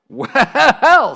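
high, loud, wobbling "well"; straightening up with effort. Acted. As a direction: heave yourself upright on the word, hammed-up. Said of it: "sitting herself up, straightening up".

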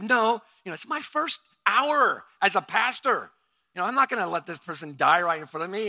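loud, high-pitched insisting; retold urgent protest. New pastor refuses to stay behind, hammed-up protest. Acting out his protest to wife.

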